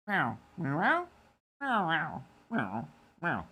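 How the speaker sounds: playful cat meows; meowing cat. Pretend. Streamer meowing for his cat. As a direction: meow like a pet cat.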